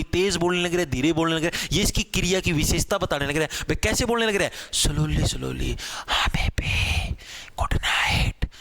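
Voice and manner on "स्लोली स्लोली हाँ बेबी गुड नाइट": whispering slowly. Shown as a demonstration. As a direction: whisper it like a bedtime goodnight. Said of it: "धीरे से बोलने लग". (धीरे से फुसफुसाते हुए)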